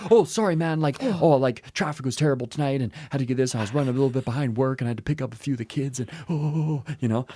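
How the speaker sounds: mock apology tumbling out with sorry "oh"s; apologetic Canadian. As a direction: play a flustered Canadian arriving late. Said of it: "So the Canadian shows up late".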